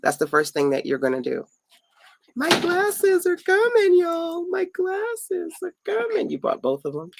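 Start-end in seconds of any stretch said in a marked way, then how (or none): 2.3-6.3 high, slow, sing-song announcement; excited sing-song. Real, not acted. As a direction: crow it out happily, drawing it long. Glasses finally being brought.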